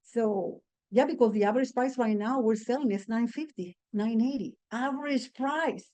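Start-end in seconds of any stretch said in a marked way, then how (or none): none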